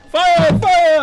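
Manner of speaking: high, loud cry of alarm; re-enacted shout. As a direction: replay the alarm at full shout. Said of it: "I went".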